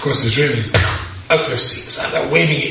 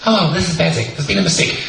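English phone message; 5 semitones higher than usual. Retold or imitated. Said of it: "exact same message, only in English".

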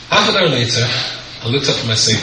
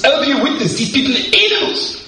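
mock outrage; 14 semitones higher than usual. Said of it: "He said".

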